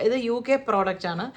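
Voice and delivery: ordinary delivery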